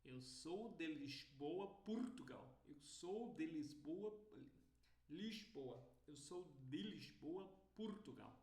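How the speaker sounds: European Portuguese accent with hushing S in Lisboa; imitated European accent. Shown as a demonstration. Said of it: "uma pessoa de lá vai falar".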